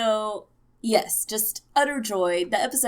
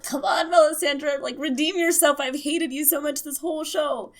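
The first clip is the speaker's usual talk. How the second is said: yelling at the TV; 7 semitones higher than usual. Retold or imitated. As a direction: urge her on like a sports fan. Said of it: "yelling at the T V".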